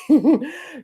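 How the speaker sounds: a high burst of real laughter. Real, not acted. She laughs at the joke.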